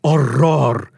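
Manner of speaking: loud, sudden cry of horror; one-word exclamation. A narrator's reading. Narrator as Paolo crying out in horror, loud exclamation. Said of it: "Paolo's one-word exclamation".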